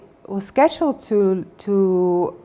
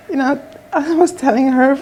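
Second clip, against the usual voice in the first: emotional, close to tears; 6 semitones higher, 4 dB louder than usual. Real, not acted. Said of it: "She almost made me cry here".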